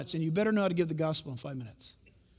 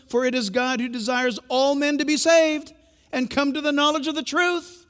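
louder, raised proclamation; scripture reading; 7 semitones higher, 6 dB louder than usual. Acted. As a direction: proclaim the verse.

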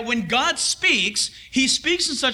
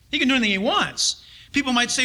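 usual speaking voice

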